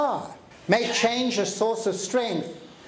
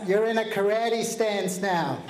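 ordinary talk, nothing marked

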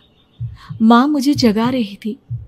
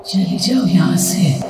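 low, slow, eerie hushed warning; a ghost murmuring go away; slow, low spectral whisper-voice; muffled ghostly voice; 7 semitones lower, slower than usual. A narrator's reading. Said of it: "दबी हुई आवाज में धीरे से".

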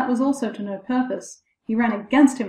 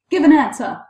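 loud, higher demand; crying out; 5 semitones higher, 8 dB louder than usual. A narrator's reading. Boy to the silent figure, crying out a demand. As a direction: call out, demanding a reply. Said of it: "cried the boy".

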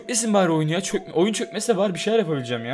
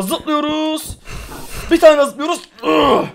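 excited jumping; 12 semitones higher, 8 dB louder, much slower than usual. Real, not acted. Gamer jumping, straining in game.

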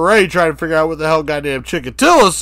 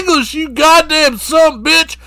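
furious shout; 11 semitones higher than usual. Retold or imitated. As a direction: scream at him to speak English. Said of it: "David Parker Ray is like".

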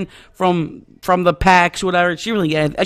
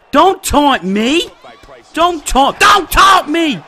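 slow high yell, building louder each time; indignant shouting; 7 semitones higher, 5 dB louder, slower than usual. Real, not acted. Gamer to taunting opponent, indignant shout. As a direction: warn them off, louder with every repeat.

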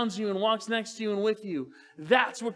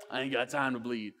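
low, softer tough-guy movie line; lowered action-hero impression; Jesse Ventura tough guy; 9 semitones lower, 5 dB quieter than usual. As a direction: growl it like an action hero brushing off a wound. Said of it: "Jesse the Body Ventura".

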